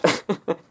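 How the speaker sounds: sudden high bright laugh. Real, not acted. Laughs at own glamorous witch joke.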